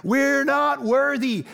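hammed-up "we're not worthy" chant; Wayne's World. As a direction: stretch it out like bowing down. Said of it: "a little Wayne's World".

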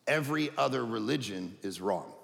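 ordinary talk, nothing marked

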